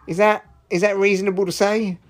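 ordinary talk, nothing marked

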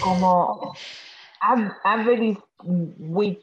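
slow, tentative stab at Aberystwyth; unsure pronunciation. Shown as a demonstration. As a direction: sound out average with haltingly. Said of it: "Cómo voy a pronunciar esto".

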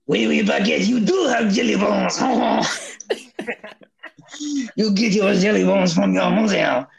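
mock French parent, overdone; exaggerated French accent scolding the child; over-the-top French accent. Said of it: "very over-the-top French accents".

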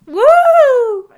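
high, loud cheer of delight; excited whoop. Real, not acted. Cheering about seeing the new horror movie, excited whoop. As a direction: whoop like you can't wait.

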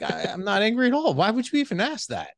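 high, defensive protest, plainly annoyed; defensive denial. Retold or imitated. Husband denying anger to wife, high defensive protest. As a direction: squeak out an indignant denial. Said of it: "I'm like".

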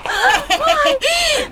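high, loud cackling laugh; wild bandit laugh. Acted. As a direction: cackle like a wild little robber. Robber girl laughs at threat. (râs sălbatic de tâlhăroaică)

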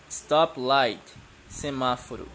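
usual speaking voice

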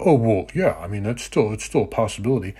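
lightly voiced sheepish backpedaling; mock the hedging excuse a little. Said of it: "they backpedal and go".

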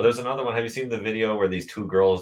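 usual speaking voice

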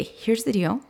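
ordinary talk

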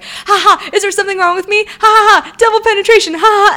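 mock giggly texts. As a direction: read the texts as a friend who can't stop giggling. Friend's giggly texts acted out.

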